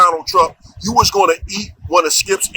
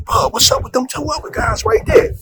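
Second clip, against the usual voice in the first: high anxious call to a buddy; urgent nervous question, pitched high; 11 semitones higher than usual. Retold or imitated. Nervous guy calling his buddy over, anxious.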